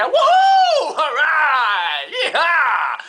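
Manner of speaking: loud, high whooping yells; cheering shout. Retold or imitated. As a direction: whoop like kids at dodgeball. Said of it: "on criait". (cris de joie d'enfants au ballon-chasseur)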